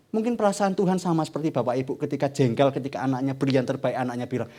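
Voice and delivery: usual speaking voice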